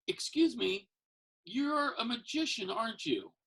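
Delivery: a stranger sure she's found him; curious woman. Retold or imitated.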